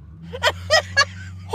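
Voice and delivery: high breathy disbelieving laugh; incredulous outraged laugh. Real, not acted. Outraged laugh at husband's reply.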